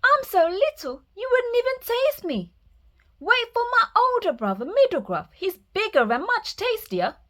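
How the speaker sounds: put-on high, squealing baby goat; high little kid goat answering; squeaky little goat. Tiny goat pleads with troll.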